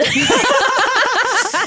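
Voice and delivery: squealing laugh; high burst of laughter. Real, not acted. Laughing at the mispronunciation.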